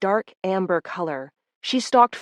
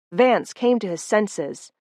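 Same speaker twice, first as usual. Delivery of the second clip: plain reading voice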